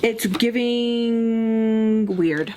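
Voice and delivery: very slow, stretched-out "giving"; drawn-out verdict. Real, not acted. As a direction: hold the middle word long before landing the last. Drawn-out verdict on his behavior.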